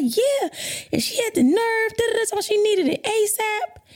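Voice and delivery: high-pitched, imitated upset whine; tearful tattling. Retelling crying to partner about client, tearful tattling. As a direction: re-enact a wailed complaint about someone. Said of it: "I started crying".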